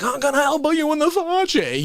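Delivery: high, comic tough-guy threat; [name]. Shown as a demonstration. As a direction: put on a cleaned-up shock-comic voice. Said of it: "when I say stuff like".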